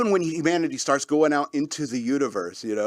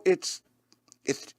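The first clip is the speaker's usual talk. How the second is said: normal talking voice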